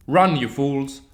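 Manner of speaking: loud, high yell; spat order. A narrator's reading. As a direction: fling it back at them mid-fight. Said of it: "He spat behind them".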